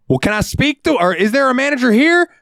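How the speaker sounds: high whiny falsetto request; mock customer voice. Cashier mimics a customer demanding a manager in a high whiny voice. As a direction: ask like a pushy customer.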